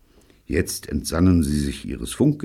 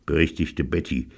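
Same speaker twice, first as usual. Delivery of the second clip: ordinary delivery, nothing marked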